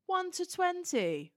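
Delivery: high, drawn-out announcer call; calling out tickets. Retold or imitated. Steward calling autograph ticket numbers, imitated high, slow call-out. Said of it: "they start calling out autograph tickets".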